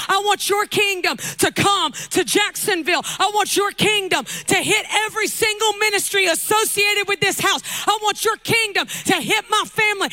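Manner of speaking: loud, high preaching prayer; shouted prayer. Acted. Preacher leading the church in a loud, high prayer. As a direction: cry it out to heaven.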